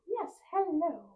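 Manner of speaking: high, playful pet-greeting voice; lifted sing-song chat to a pet; talking to Scramble. Real, not acted. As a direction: coo a hello and goodbye in a high pitch. Greets Scramble, who interrupts.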